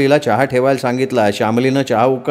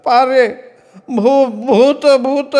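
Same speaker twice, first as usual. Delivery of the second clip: high, broken stutter on भू- भूत; stammering in fright; 11 semitones higher, much slower than usual. Acted. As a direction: stammer out the words, terrified. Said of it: "तोत्र्या आवाजात म्हणाला". (घाबरून तोतरं, उंच आवाजात)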